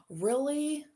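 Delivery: gasp, then a high, loud Really?; surprised gasp. Real, not acted. Finding a card about her first language, she gasps in real surprise. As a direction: genuinely caught off guard, sharp gasp.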